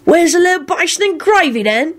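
high-pitched Cockney rogue asking; nosy, keen question; interested. A narrator's reading. Mundungus asking Lupin about someone, interested. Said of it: "he said with interest".

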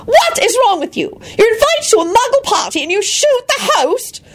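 high, shrill scolding wife; exasperated scolding. A narrator's reading. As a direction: berate him in disbelief.

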